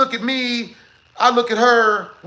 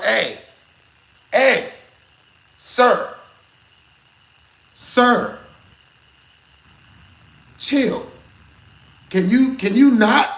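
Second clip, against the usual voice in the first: raised voice, slow pauses between each "sir"; calling out firmly; 4 dB louder, much slower than usual. Retold or imitated. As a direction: call him off across the dance floor, louder each time. Telling a stranger to stop.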